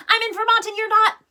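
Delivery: loud, high, fast triumphant blurt; proud dad shouting at the state line. Acted. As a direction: burst out with it, gleeful and quick. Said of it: "then blurted".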